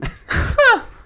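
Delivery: high loud burst of laughter. Real, not acted. Amused by her own name mix-up, laugh.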